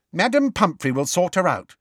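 high, assured reply; firm. A narrator's reading. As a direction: reassure them firmly, no doubt allowed. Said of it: "said Alicia Spinett firmly".

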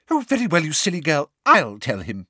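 put-on teacher losing patience with the girl; snapped. A narrator's reading. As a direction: snap at the silly girl. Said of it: "snapped Umbridge".

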